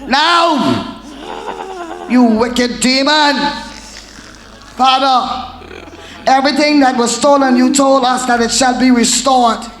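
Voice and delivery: loud, forceful preaching, often high; shouted deliverance commands. Acted. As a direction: bark commands at the demon at full force.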